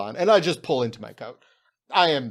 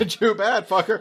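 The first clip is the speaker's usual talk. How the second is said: higher, louder taunting snap; mock taunt; 7 semitones higher, 4 dB louder than usual. Goblin taunting the talking globe, high and cocky. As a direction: taunt it with glee. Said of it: "I'd be like".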